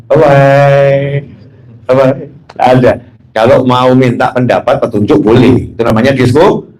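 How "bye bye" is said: mock dismissal. Brushes off someone wanting to confide.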